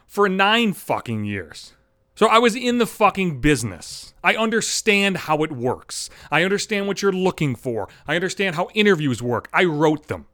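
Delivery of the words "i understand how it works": ordinary talk, nothing marked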